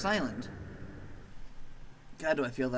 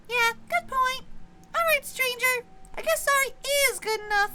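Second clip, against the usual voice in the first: much higher, louder squeaky threat; squeaky tiny maggot; 7 dB louder than usual. Acted. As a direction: squeak a tough-guy warning. Maggie the maggot.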